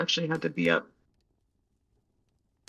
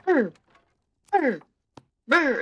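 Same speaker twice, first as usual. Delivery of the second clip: repeated meows rising higher and louder; insistent cat meows; 10 semitones higher, 5 dB louder than usual. Retold or imitated. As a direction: keep meowing like a demanding kitten. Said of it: "she just keeps going".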